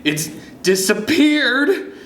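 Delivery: high, drawn-out cry of disbelief; slow, high-pitched dramatic alarm. A narrator's reading. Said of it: "you cry".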